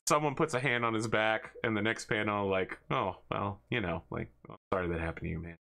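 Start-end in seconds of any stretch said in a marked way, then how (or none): 2.9-5.6 softer, lower mumbled sympathy; awkward consolation. Acted. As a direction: mumble it sheepishly. Consoling aside in comic.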